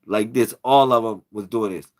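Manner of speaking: ordinary delivery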